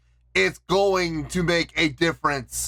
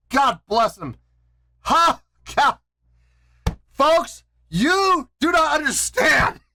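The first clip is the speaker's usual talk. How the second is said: high-pitched, emphatic jubilation; euphoric relief; 7 semitones higher than usual. Real, not acted. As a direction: exclaim like a weight has just lifted. Relieved, says weight has lifted.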